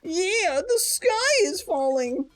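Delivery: high stammering hen; hen's voice. Acted. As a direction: cluck out disbelief. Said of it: "Henrietta said".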